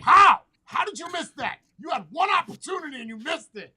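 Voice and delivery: imitating a furious gamer, high and shouting at a teammate; gamer raging. Gamer on a mic yelling at a teammate who missed, shouting. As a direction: scream it like you just watched your squad blow the easy kill. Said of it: "He's like".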